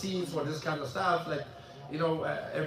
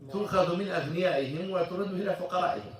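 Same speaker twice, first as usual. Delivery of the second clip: quick recited quote; much faster than usual. Retold or imitated. Quoting the hadith quickly.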